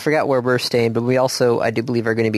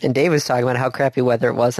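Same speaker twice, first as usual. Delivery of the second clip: normal talking voice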